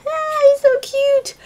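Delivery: very high, drawn-out squeal; too excited. Real, not acted. Cooing over a cute gift, excited squeal. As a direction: squeal slowly over something adorable. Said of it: "I'm too excited".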